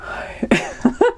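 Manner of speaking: breathless, mostly voiceless laugh; fail to hold in the giggles; suppressed laugh escaping. Real, not acted. Reader trying to get it together, cracking up. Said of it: "I'm gonna get it together".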